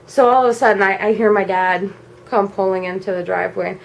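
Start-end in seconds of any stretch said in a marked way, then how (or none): none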